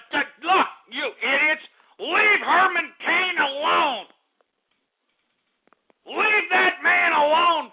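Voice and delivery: rising, slowed angry rant; slow, mounting indignant yell at the callers; angry outburst. Acted. Host yelling at prank callers.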